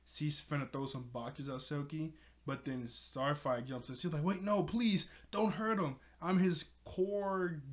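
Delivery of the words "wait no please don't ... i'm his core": higher mock-desperate plea; acted urgent appeal, pitched up; pleading. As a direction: imitate someone begging them to stop. Starfire pleading for Silky, retold.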